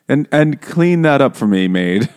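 loud, haughty mock order to a maid; bossy lady of the house. Mocking the rich lady ordering her maid, haughty. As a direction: order the maid about, hammed up.

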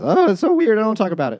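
loud, very high-pitched put-on outburst; mock dismissive Stan. Imitating Stan refusing to discuss the clown, mock freak-out. Acts out Stan avoiding the topic.

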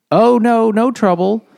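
high, loud, falsely sweet denial; father hiding trouble from a farmer, hammed-up sweet voice; mock innocent father. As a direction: brush the farmer off with fake cheer.

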